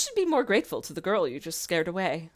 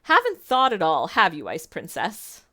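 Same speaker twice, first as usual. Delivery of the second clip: taunting; 8 dB louder than usual. Acted. Delora taunting the princess.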